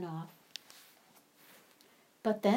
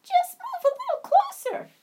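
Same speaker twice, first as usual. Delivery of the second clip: very high, tiny squeak of a mouse; squeaky mouse; 8 dB louder than usual. Acted. Drenched mouse squeaks to be let in under the mushroom. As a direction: squeak it out like a soaked little mouse.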